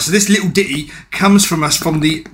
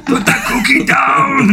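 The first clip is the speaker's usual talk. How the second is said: mock Arnold shout, slow and higher; Schwarzenegger impression; 7 semitones higher, slower than usual. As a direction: bark it like a hammed-up action hero. Schwarzenegger quotes impression round.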